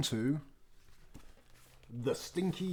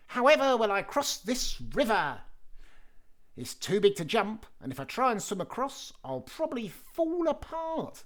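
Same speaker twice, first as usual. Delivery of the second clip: much higher, fretful; worried cheese man; 9 semitones higher than usual. Acted. The cheese man frets at the river, high and worried. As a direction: worry at the riverbank. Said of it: "said you-know-who".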